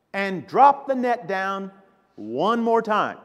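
loud, emphatic mock indignation; exasperated protest. Acts out Peter protesting to Jesus.

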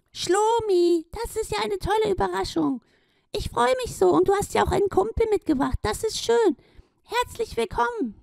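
very high, gushing glowing hippo voice; a tiny shining hippo overjoyed; delighted Gloria. Acted. Gloria welcomes Schlomi and his friend, very high and delighted.

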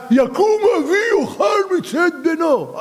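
high, slow, roaring shout; Esau. Acted. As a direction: bellow a rude demand, drawing it out. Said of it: "ועשיו". (צעקה גסה וגבוהה של עשיו)

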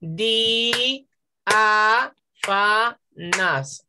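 four spaced, drawn-out beats: di, a, fa, nas; syllable by syllable. Shown as a demonstration. Teacher separating the hiatus for pupils, syllable by syllable. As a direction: DI - A - FA - NAS, each syllable apart. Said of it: "Hay cuatro sílabas".

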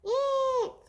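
high, loud shrill eek; squeal. Acted. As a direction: let out a startled squeal. Animal squeals at the taste.

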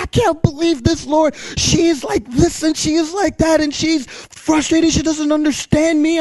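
high-pitched exasperated rant; venting. Retold or imitated. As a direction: re-enact pouring out frustration to God. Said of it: "I'm venting to the Lord".

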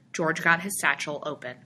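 usual speaking voice

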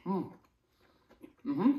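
humming while chewing; muffled closed-mouth hum; mouth full, chewing. Real, not acted. Seen 1: Took a forkful at -1; closed mouth, full cheeks, chewing.

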